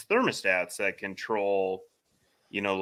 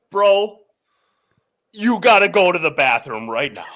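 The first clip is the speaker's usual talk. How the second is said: laughing call; 8 semitones higher, 11 dB louder than usual. Retold or imitated. Movers calling him over, laughing.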